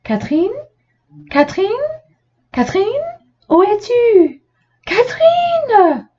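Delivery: high, rising calls of a name; calling out. A narrator's reading. Le papa cherche sa fille dans le magasin, appels aigus. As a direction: search the shop, calling her. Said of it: "en appelant".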